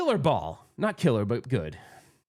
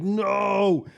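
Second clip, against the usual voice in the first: long, loud, higher-pitched wail; anguished athlete's no; 5 semitones higher, 5 dB louder than usual. Retold or imitated. Player mimicking athletes with hands on head, anguished cry. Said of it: "like professional athletes do".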